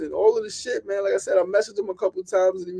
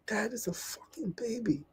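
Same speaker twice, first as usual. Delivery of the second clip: whispered, much softer, in shock; grieving whisper; 5 semitones higher, 14 dB quieter than usual. Real, not acted. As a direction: breathe it out, barely voiced. Grieving a young death.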